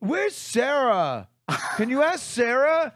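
high, drawn-out questioning; mock a clueless kid passing the buck; helpless teen. Teen staffer passing a customer on to Sarah, mock helpless kid. Voicing teens passing him on.

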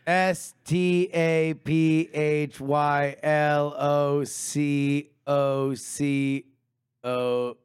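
slow spelling. Shown as a demonstration. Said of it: "spelling it out".

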